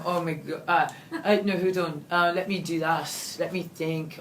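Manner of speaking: normal talking voice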